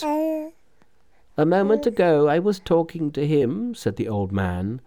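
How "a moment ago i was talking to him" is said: put-on high, emphatic old man in amazement; astonished old man. A narrator's reading. Old man astonished by the miracle, amazed and overwhelmed. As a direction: marvel at it in a raised pitch.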